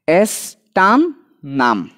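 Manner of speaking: said slowly as one word, এস্টান নাম drawn out; slow whole name. Shown as a demonstration. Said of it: "C level ভাগ করে দিই".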